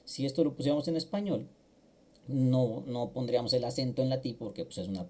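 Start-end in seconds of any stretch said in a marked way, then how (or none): none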